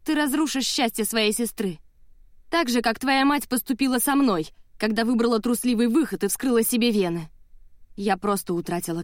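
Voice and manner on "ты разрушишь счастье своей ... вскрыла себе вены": higher-pitched, scornful accusation; contemptuous. A narrator's reading. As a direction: accuse with open disdain. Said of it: "презрительно протянула Мелисса".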